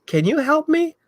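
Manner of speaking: dog asking for help. Retold or imitated. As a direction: voice the dog way up high, asking sweetly. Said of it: "he's like".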